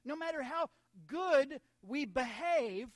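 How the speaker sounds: slow, forceful pulpit delivery; emphatic preaching. Real, not acted. Preacher telling church nobody can earn it, emphatic preaching. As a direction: hammer each key word to the congregation. Preacher drives home his point.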